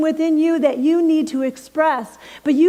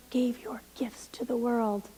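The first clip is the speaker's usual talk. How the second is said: whispered, solemn ending; hushed warning; 3 semitones lower, 12 dB quieter than usual. Real, not acted. Minister warning the congregation in a hushed whisper. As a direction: drop to a whisper.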